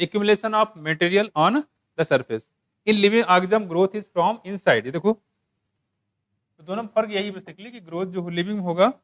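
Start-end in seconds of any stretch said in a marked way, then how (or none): 0.1-4.8 slow, deliberate English reading; textbook read-aloud. Real, not acted. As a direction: read the text out slowly, phrase by phrase. (धीरे-धीरे पाठ पढ़कर सुनाते हुए)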